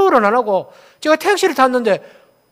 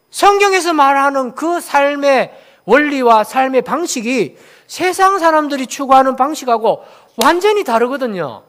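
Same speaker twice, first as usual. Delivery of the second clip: ordinary talk, nothing marked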